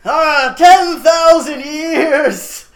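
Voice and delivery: huge drawn-out genie bellow; Robin Williams. Retold or imitated. Impersonating the genie for a laughing co-host, long manic shout. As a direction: shout it high, stretching each word. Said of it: "In his Robin Williams way".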